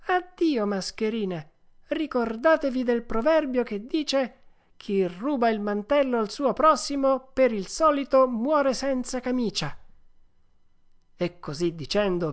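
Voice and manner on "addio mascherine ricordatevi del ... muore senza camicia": high, emphatic puppet voice; scornful Pinocchio. Acted. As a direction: preach a proverb at swindlers and dismiss them. (burattino sprezzante, voce acuta e sentenziosa)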